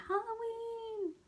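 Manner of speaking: high, slow, stretched shout of Halloween. Acted. Thomas shouting to the class, high drawn-out Halloween. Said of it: "Thomas shouted".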